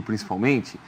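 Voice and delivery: ordinary talk, nothing marked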